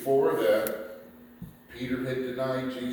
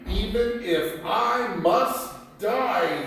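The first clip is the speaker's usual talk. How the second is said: slow, raised, earnest vow; solemn vow; 8 semitones higher, 5 dB louder, slower than usual. Acted. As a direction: swear it slowly like Peter would. Peter's vow of loyalty.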